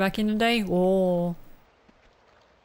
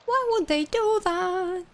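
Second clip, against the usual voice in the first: slow, high creaking-door voice; creaky door; 9 semitones higher, slower than usual. Acted. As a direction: stretch the words like a creaking hinge.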